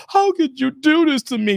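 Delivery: hammed-up hurt victim; mock wounded partner asking how you could; mock whiny woman. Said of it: "they're gonna feel some type of way".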